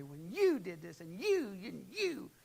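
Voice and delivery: loud, high, jabbing accusation; accusing. Shown as a demonstration. Spouse in an argument, loud and accusing. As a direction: stab each "you" at them. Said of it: "But to stand there and just say".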